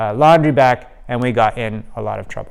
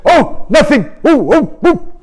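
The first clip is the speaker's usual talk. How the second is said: put-on caught kids; 13 semitones higher, 4 dB louder, slower than usual.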